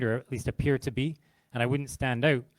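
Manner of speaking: ordinary talk, nothing marked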